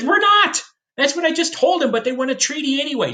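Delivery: a hint of an exasperated president; Washington's voice. History teacher voicing Washington, high-pitched and exasperated.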